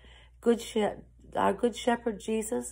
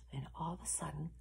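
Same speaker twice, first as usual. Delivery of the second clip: very soft, quick lead-in; secretive storyteller hush; hushed suspense; 5 semitones lower, 17 dB quieter, much faster than usual. A narrator's reading. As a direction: drop right down to build suspense. Suspense before a surprise.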